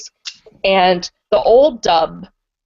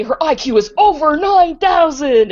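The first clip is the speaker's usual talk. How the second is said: higher, louder meme quote; quoting the meme; 8 semitones higher, 5 dB louder than usual. Retold or imitated. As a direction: hype it up like the old Dragon Ball Z meme. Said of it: "Umino says of Ami".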